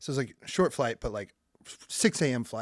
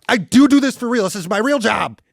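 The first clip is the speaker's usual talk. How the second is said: loud, higher, insistent outburst; exasperated comic self-defense, raised voice; indignant protest; 6 semitones higher, 10 dB louder than usual. Retold or imitated. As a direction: protest loudly that you are legitimate. Protesting in an acted retelling.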